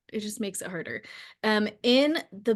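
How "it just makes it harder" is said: drops to a near murmur on "It just makes it harder"; soft repeat. Real, not acted. Repeating her point softly to the listener, quiet.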